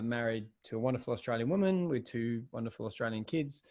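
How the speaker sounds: ordinary talk, nothing marked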